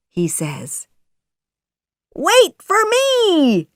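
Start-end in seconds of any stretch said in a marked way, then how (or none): 2.1-3.7 high, slow drawn-out call; shouting. A narrator's reading. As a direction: call after them, stretching the words. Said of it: "shouts Jiminy Cricket".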